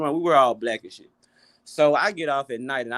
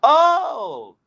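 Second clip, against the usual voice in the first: high, drawn-out imitation of Indonesian coworker; slow high-voiced comic menace; 11 semitones higher, much slower than usual. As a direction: mimic her slowly, pitched way up. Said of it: "this Indonesian lady I work with".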